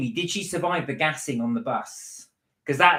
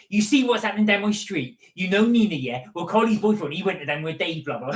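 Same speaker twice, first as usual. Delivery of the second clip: higher, chatty West Country auntie; West Country gossip accent; 5 semitones higher, 4 dB louder than usual. Retold or imitated. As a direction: gossip over the fence in broad West Country. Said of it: "I had to do the accent".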